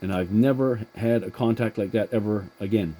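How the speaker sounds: ordinary delivery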